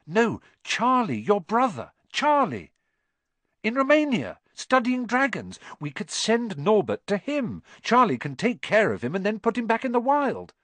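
high-pitched, eager explaining; excited idea. Acted. Harry telling Ron about his brother's help, excited.

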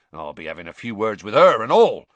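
mean every word darkly; grimly. Acted. Hagrid to Harry, grimly promising words. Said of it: "said Hagrid grimly".

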